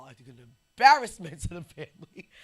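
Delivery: loud stern scold. Retold or imitated. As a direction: scold the whole family. Grandma scolding loudly.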